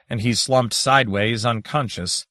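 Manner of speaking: plain reading voice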